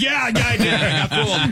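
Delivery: high, laughing burst of yeah-yeah; excited admission. Retold or imitated. Retelling his answer to Steve, excited admission. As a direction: own up in a high, laughing rush.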